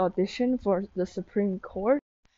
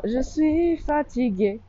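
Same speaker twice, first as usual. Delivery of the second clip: burping the words; 6 semitones higher than usual. Real, not acted. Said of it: "sorry, oh my god, burp".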